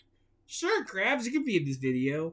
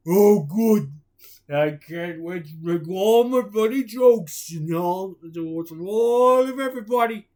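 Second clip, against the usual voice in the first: happy Patrick; 4 semitones higher, 9 dB louder than usual. Acted. Patrick voiced cheerfully.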